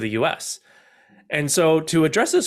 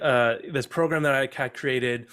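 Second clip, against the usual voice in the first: ordinary talk, nothing marked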